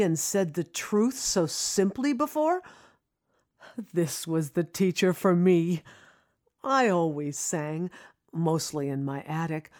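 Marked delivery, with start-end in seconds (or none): none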